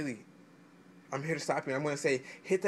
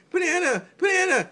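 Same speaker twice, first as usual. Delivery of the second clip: much higher, louder cartoonish sing-song; silly mock reply; 12 semitones higher, 7 dB louder than usual. The buyer answering the threat, in a high goofy mock voice. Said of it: "He gonna be like".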